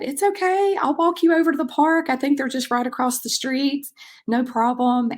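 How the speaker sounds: high-pitched, upbeat comforting voice; bright high "it's okay" voice for a child; reassuring a child. Retold or imitated. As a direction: reenact soothing an upset little kid, pitched well up. Coach reassures an upset young child.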